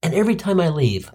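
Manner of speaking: normal talking voice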